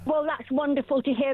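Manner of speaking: ordinary talk, nothing marked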